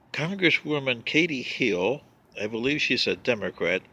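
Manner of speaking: normal talking voice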